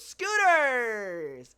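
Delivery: sudden loud, high, long-held holler; street seller's shout. Retold or imitated. Podcaster imitating the scooter seller, sudden loud drawn-out shout. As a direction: break into a vendor's drawn-out cry. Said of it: "and then it just goes".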